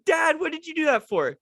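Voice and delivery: put-on indignant kid cry. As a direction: shriek at dad in shock. Kid soaked by water bottle.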